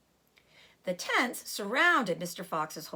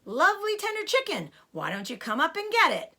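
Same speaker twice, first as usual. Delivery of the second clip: taunting; 6 semitones higher, 4 dB louder than usual. A narrator's reading. Farmer taunting fox with chicken.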